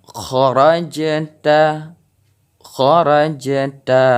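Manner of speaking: very slow "kharajta" with the j bouncing; qalqalah on jim. Shown as a demonstration. Teacher demonstrating qalqalah, slow bounced jim. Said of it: "kita dengar suara pantulan".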